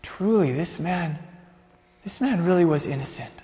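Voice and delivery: soft, halting realisation; hushed commander's admission; subdued awe. Acted. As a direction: say it quietly, pausing as it sinks in. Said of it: "staring at the young man who just died".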